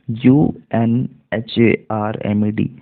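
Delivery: usual speaking voice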